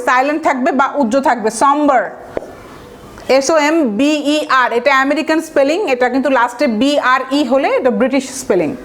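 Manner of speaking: ordinary talk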